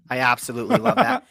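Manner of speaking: high, laughing through the words; beaming, laugh bubbling into it; laughing delight. Real, not acted. Cohost thanks fan in chat, answered with laughing delight.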